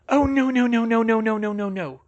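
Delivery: high, loud run of dismay, tailing down; frantic alarm, falling away; panicking. A narrator's reading. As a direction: let the words tumble out in panic. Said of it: "she started to panic".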